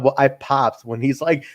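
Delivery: ordinary delivery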